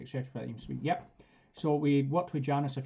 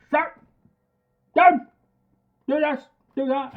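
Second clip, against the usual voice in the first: much higher, much louder shouted orders; screaming commands; 12 semitones higher, 11 dB louder than usual. Retold or imitated. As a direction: yell them like a drill sergeant. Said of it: "almost like screaming at you".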